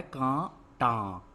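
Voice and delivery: कांटा very slow, in two separate syllables; कांटा drawn out with a gap in the middle. Shown as a demonstration. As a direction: sound out कांटा in two held pieces. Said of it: "क्या है".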